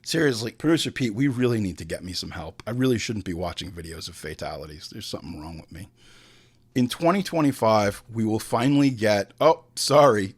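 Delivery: normal talking voice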